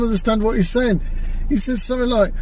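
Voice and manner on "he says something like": ordinary delivery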